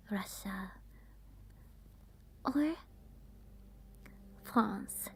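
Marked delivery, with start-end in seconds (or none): none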